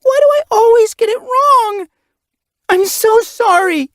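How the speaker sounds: put-on loud, high, pleading young cat; panicked apology. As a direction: beg forgiveness in a rush of panic. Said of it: "Panic flashed through her".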